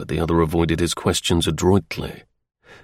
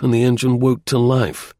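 plain reading voice

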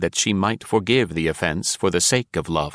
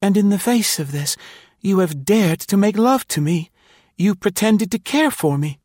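high-pitched, wounded woman's accusation; quivering reproach; 13 semitones higher than usual. A narrator's reading. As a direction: reproach him in a high, frightened voice. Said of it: "Her voice was very low, and it quivered".

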